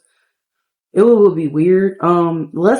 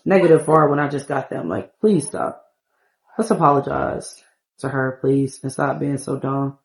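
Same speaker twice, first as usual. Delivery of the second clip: lower, lightly voiced man scolding himself; a hint of a deeper guy telling himself to stop; scolding Sim guy; 6 semitones lower, 3 dB quieter than usual. Man Sim urges himself to apologize to her, lower and fed up.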